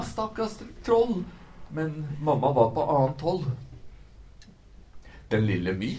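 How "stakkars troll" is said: crying out. A narrator's reading. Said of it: "Han skreik på mamma".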